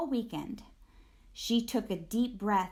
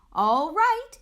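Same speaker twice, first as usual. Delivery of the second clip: raised, higher teacher's call for attention; 8 semitones higher, 8 dB louder than usual. A narrator's reading. Teacher calling the noisy class to order, loud.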